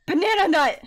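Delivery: loud and high Banana nut; excited shout. Acted. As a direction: blurt it out thrilled. Excited answer about bread.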